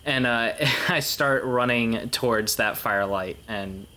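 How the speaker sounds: ordinary talk, nothing marked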